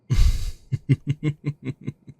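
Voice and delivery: a light teasing chuckle at the shy guest; laugh, amused by the newcomer's nerves. Acted. Hostess laughing at a bashful new client, amused chuckle.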